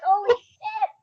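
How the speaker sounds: retelling her shock at the photo, mock startled; shocked exclamation. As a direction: caught off guard, blurt it. Shocked at mom's photo comment.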